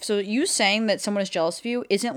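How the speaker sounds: ordinary delivery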